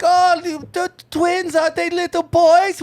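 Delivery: high-pitched imitation of an old lady. Old lady asking about the twins, high mock voice.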